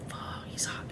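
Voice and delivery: sighed, barely-there whisper; breathy aside. Real, not acted. Streamer swooning over Val Kilmer, breathy whisper. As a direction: whisper it under your breath after a sigh. Aside about an attractive actor.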